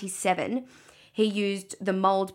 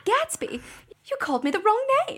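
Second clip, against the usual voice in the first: Cecilia; 3 dB louder than usual. Pretend. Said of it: "You be the girl".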